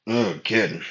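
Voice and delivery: usual speaking voice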